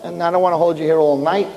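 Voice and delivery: plain reading voice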